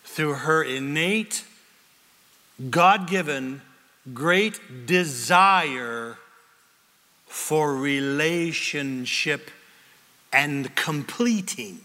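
slow, lingering phrases; slow emphasis. Real, not acted. Preacher stressing Eve's longing, slow and deliberate. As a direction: drag each phrase out for weight.

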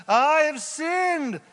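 high, loud, stretched-out cry; dramatic confession. Acted. Pharaoh confesses to Moses and Aaron, hammed-up cry. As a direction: wail the admission theatrically, very slowly. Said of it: "And he said to them".

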